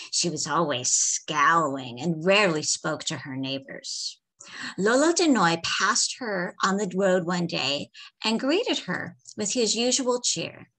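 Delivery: plain reading voice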